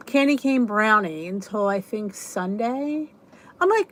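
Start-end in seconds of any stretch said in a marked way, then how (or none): none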